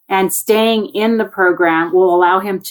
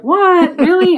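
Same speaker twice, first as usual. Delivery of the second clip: much higher, slightly louder; mock disbelief; 11 semitones higher, 3 dB louder, slower than usual. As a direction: act astonished at the kids' complaint. Mock disbelief at kids' complaint.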